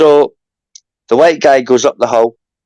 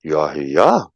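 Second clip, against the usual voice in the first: lightly voiced God; 11 semitones lower, 4 dB quieter than usual. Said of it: "He just said".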